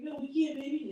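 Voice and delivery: lightly voiced gentle mom; a hint of a mother saying no softly; mild mom. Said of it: "She's like".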